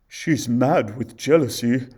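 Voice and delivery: huntsman. A narrator's reading. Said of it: "said the huntsman".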